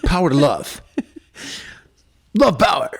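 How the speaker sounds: a playful high chant of "Love power"; excited cheer. Shown as a demonstration. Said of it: "Oh, do it like this".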